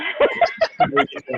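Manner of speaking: a sudden high burst of laughter; cracking up mid-sentence. Real, not acted. Laughs at a joke in the room.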